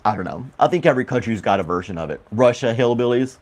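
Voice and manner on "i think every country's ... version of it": ordinary talk, nothing marked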